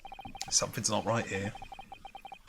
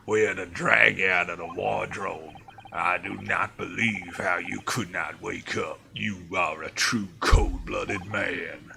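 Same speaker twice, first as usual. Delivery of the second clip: a light gruff guard, louder and accusing; 3 semitones lower, 4 dB louder than usual. Acted. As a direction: play the gruff sailor scolding the sleeper, louder. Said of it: "He had, like, a really gruff voice".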